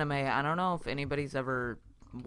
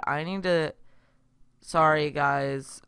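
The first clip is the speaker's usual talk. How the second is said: ordinary delivery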